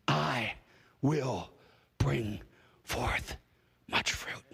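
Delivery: unhurried South African voice that trails off; South African accent. Retold or imitated. Preacher quotes his South African friend's slow wisdom in a South African accent. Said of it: "he's got a really South African accent".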